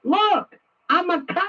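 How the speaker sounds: mock a kid showing off his badge; little boy acting. Mocking the FBI director as a boy playing cop. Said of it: "He's a little boy acting".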